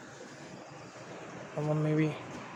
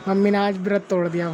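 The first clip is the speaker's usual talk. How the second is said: ordinary talk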